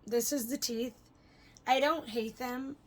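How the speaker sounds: ordinary talk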